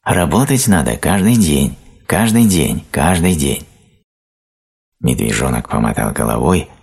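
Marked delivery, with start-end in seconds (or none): 0.0-3.7 high, insistent repetition; mildly raised, high ant voice; nagging ant cries. A narrator's reading. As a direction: drum it in as a scolding ant. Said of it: "а кричал ещё громче".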